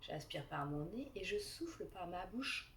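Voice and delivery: calm guided relaxation voice; guided meditation. Acted.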